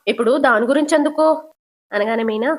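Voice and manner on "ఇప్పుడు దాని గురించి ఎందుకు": snapped question, higher and a little louder; mild angry voicing of the mother-in-law; mother-in-law snapping at Meena, lightly angry; angry retort. A narrator's reading. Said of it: "Prabhavati కోపంగా".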